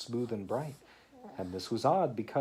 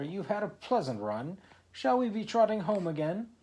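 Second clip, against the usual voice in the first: cheery carpenter; 9 semitones higher, 4 dB louder than usual. Acted. Carpenter calling to oysters.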